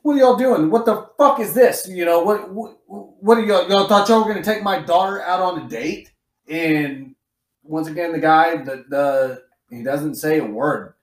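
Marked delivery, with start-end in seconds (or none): 0.0-6.1 hammed-up angry father confronting the boys; mock indignant dad demanding answers; imitating an outraged dad. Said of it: "And the guy's like".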